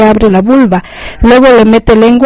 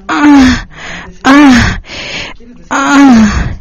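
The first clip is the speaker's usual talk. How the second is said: higher drawn-out cries; repeated raised outcries; acted cry; 4 semitones higher, much slower than usual. A narrator's reading. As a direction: cry out in spaced bursts. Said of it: "en un rato grita".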